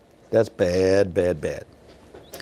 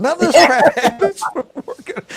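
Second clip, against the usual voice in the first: laughing; 14 semitones higher, 6 dB louder than usual. Retold or imitated. Coworker's line retold laughing.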